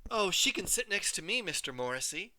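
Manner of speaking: high, sugary offer; falsely sweet. A narrator's reading. Rival girl offering the seat, falsely sweet. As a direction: sweeten it with a sly edge. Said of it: "She smiled her square smile".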